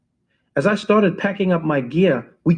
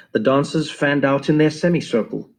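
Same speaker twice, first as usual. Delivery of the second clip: ordinary talk, nothing marked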